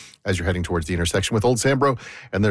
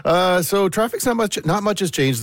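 high mock voice for traffic, easing down; put-on phone voice; 10 semitones higher than usual. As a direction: start the traffic report in the silly high voice. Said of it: "do traffic like that".

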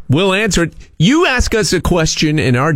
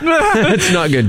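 slow, higher words through a laugh; cracking up at the story; 8 semitones higher, much slower than usual. Real, not acted. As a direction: laugh it out slowly.

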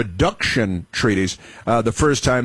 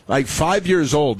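normal talking voice

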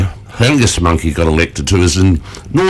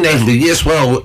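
ordinary talk

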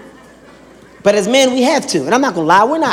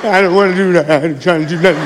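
sheepish mumble; 4 semitones lower than usual. Acted. Acted sheepish man.

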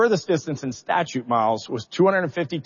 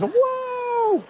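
high, excited whoa; imitated crew cheer. Crew cheering the dropping distance number, excited high whoa. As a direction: mimic the whole crew cheering at once. Crew reacts to good news.

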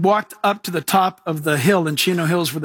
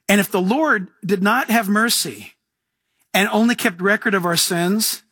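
normal talking voice

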